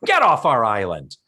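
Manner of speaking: a guy imitating a rude islander, playfully loud; mock hostile shout. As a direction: bark it like a territorial local. Acting a hostile character line.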